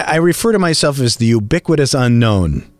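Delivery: usual speaking voice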